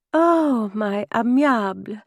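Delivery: high, effusive greeting; delighted cry. A narrator's reading. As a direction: cry it out adoringly, starting high. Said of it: "she cried".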